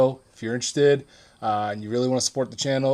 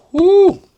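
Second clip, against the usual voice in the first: relieved whoop; 11 dB louder than usual. Real, not acted. Recovering after choking on fly.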